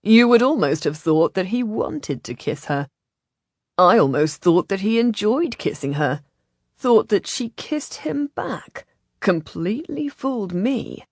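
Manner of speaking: playful, put-on character voice; emphatic, lilting wonder; admiring tease. A narrator's reading. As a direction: tease them with mock admiration. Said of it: "Freeze said admiringly".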